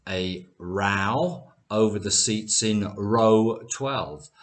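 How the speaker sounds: first "row" rhymes with "cow", second "row" with "go"; slowly, "row" as rau, then "row" as roe; both rows contrasted. Shown as a demonstration. Teacher repeats the example for learners, "row" as rau then roe. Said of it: "both words are spelt the same".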